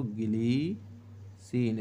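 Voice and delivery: ग्लिसिन very slowly in syllables, "gi-lee... seen"; drawn-out pronunciation. Shown as a demonstration. Teacher answering their own question for students, ग्लिसिन slowly drawn out by syllable. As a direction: stretch ग्लिसिन out, holding the "lee". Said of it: "क्या बोलते हैं इसको?".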